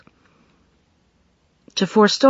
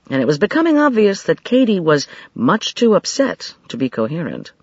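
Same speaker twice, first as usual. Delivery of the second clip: ordinary delivery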